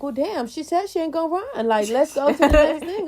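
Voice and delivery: high, loud exasperation; hammed-up impatience with a dragging scene; exasperated viewer. Acting out a viewer fed up with the scene, exasperated. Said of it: "and I'm like".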